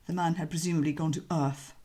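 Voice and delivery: usual speaking voice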